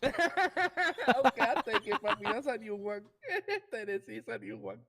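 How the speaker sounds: laughing, high and loud. Real, not acted. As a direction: crack up at the Tennessee guess. Laughing at Tennessee guess.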